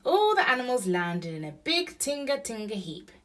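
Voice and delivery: ordinary delivery, nothing marked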